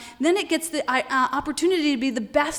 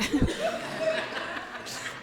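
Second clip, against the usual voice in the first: high, airy laugh; real laugh; 14 semitones higher, 6 dB quieter than usual. Real, not acted. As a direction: laugh helplessly at your own silliness. Laughs at her own silly game.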